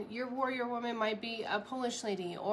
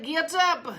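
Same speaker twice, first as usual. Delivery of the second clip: loud, higher barked Get up, a hint of a Russian accent; warrior woman voice; 8 semitones higher, 9 dB louder than usual. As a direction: command her up in a light Russian-ish accent. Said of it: "I tried to do this accent".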